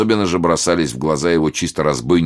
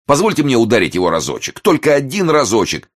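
high, louder, eager begging; pleading; 9 semitones higher, 5 dB louder than usual. A narrator's reading. Brissenden begs for one blow at the reporter, pleading. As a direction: beg for it, climbing higher. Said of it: "и стал умолять".

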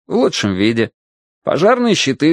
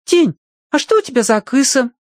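put-on very high-pitched small child; tiny high voice; little boy asking; 13 semitones higher than usual. A narrator's reading. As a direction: pipe up with a curious kid's question. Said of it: "подошёл Егорка, спросил".

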